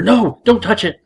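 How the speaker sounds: husband snapping at his wife not to touch. Acted. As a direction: bark it at her to back off. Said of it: "Mike instantly snapped at her".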